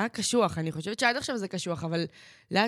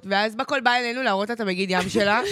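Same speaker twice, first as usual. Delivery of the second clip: ordinary talk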